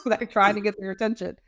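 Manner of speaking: ordinary delivery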